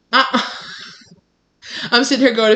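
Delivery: laughing quick aside. Real, not acted. Vlogger laughing at her cat, fast and amused. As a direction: laugh through the words.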